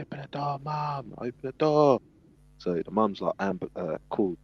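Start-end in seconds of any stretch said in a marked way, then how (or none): 0.0-2.0 frantic begging. Retold or imitated. Trapped girl pleading with her mum, high then shouting. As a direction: beg mum through the door, rising to a yell.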